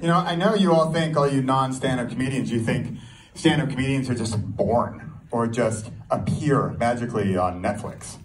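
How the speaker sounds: ordinary delivery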